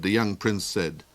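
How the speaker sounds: ordinary delivery, nothing marked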